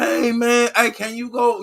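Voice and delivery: overjoyed viewer greeting his favorite streamer; gushing mock fan. A streamer playing a fan who missed his channel, high and gushing. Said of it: "Y'all supposed to be like".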